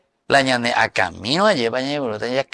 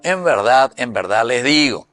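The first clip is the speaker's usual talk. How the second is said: scripture reading; 5 dB louder than usual. Acted. Reads the Bible verse aloud.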